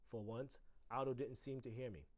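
ordinary delivery, nothing marked

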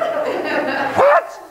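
high-pitched mock outrage; incredulous outburst. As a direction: react as if utterly appalled. Mock outrage at the cold refusal.

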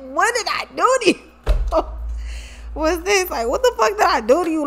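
amused, high-pitched mock bewilderment; laughing mock protest. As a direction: crack up while imitating his bewildered complaint. Laughing as she quotes him.

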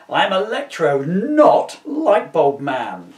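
loud, slow indignant snap; villain snapping back at the reporter; snapped. Acted. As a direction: bite off each word, offended. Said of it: "he snapped".

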